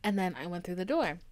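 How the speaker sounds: ordinary talk, nothing marked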